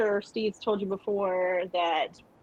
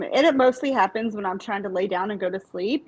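normal talking voice

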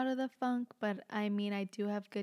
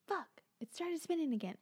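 higher-pitched retold exclamation; mock dismay in a raised voice; uncle's exclamation; 7 semitones higher than usual. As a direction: imitate an exasperated uncle, pitched up. Retelling uncle's reaction at the washer.

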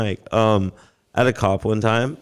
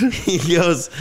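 normal talking voice

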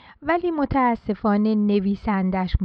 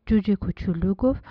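ordinary delivery

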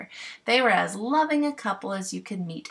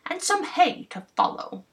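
louder, higher bumpkin giving orders; bossy clown voice; 10 semitones higher, 3 dB louder than usual. Acted. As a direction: boss the fairies like a proud clown. Clown voiced, donkey food.

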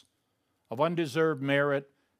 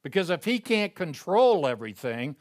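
normal talking voice

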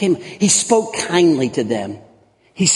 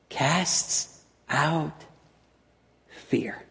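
much softer, slow, word by word; hushed answer; 9 dB quieter, much slower than usual. Real, not acted. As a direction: let each word drop softly. Answers own question, dramatic hush.

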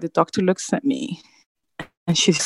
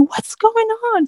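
retold scream. Mother sees baby given oxygen, high retold scream. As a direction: re-enact a panicked scream without full volume. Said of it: "And I'm screaming".

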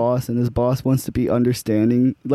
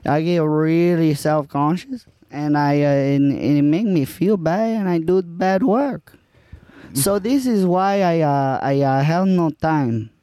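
light put-on Spanish accent. Improv scene as Juan.

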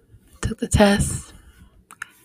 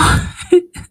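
tearful laugh; 10 semitones higher, 5 dB louder than usual. Real, not acted. Tearful laugh after announcement.

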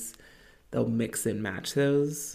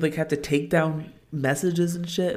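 ordinary delivery

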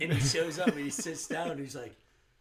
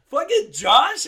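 higher, louder shocked "Fucking Josh?"; retold disbelief; 11 semitones higher, 12 dB louder, much slower than usual. Coworker recalls learning the rude bartender was Josh, shocked. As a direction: blurt it out in shock. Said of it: "And I'm like".

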